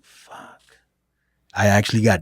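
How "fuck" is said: very low, barely voiced whisper; hushed dread. Wrestler realizing he's up in the first match, hushed dread. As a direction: swear under your breath, almost no voice.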